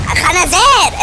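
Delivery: ordinary talk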